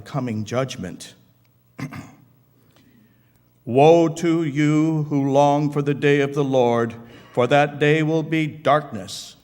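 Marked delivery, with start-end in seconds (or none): 3.6-9.4 loud, raised declamation; prophetic proclamation. Acted. Paul quotes prophet Amos on the coming judgment, loud proclamation. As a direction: proclaim the warning loudly to all.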